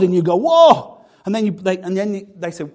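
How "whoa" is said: startled whoa. Retold or imitated. Stunned by a bold prophecy.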